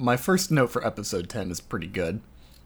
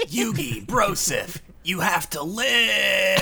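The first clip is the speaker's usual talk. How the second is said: higher, slow earnest bro plea; frat bro; 7 semitones higher, slower than usual. Retold or imitated. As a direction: beg like a devoted bro. Continuing frat bro bit.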